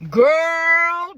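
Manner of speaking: emphatic cautioning call; drawn-out warning. Real, not acted. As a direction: stretch it out as a plea to listen. Warning other women directly.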